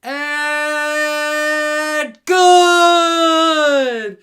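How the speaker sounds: loud, high, drawn-out shout; long triumphant call; excited call. Real, not acted. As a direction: hold the word like a winning kick. Game-winning kick called.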